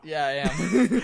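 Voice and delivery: loud, laughing reply. Real, not acted. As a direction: laugh it out, cheeky. Teasing reply.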